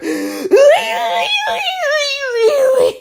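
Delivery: high, long warbling cry; imitated high-pitched yowl; mock wailing. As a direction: let loose a loud, wobbling cartoon wail. Ends the pickle jar impression.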